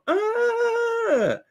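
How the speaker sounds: high wailing cry, hammed up; mock wailing cry. Imitating the woman crying on the ground, mock high wail. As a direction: cry out like the hurt lady on the ground. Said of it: "all you could hear is her crying".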